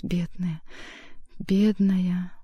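muttering. A narrator's reading. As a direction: murmur it into her hair. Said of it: "стал бормотать".

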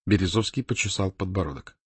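ordinary delivery

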